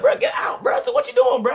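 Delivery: high-pitched retold shout; alarmed protest. Telling homeboy to get out of bathroom, alarmed shout. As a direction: yelp it, shooing him away in alarm.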